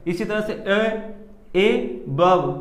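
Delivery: ए drawn out long, बव short; teacher demo of stressed ए and weak बव. Shown as a demonstration. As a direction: stretch ए slowly, clip बव. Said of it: "ए हमने खींचा तो ये straight हो गया".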